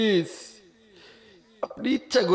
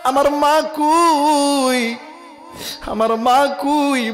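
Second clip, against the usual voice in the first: raised, slow wailing question; plaintive pleading; 7 semitones higher, 4 dB louder, much slower than usual. Acted. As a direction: stretch out the child's plea for mother. Son anxiously searches for mother.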